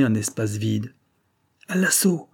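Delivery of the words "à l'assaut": high rallying shout to the attack; a charging leader's high cry; battle cry. A narrator's reading. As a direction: urge the men forward with a high call. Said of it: "cria Forcetta".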